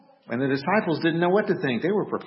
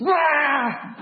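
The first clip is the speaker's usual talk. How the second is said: startled yelp; 11 semitones higher than usual. Retold or imitated. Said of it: "And she just".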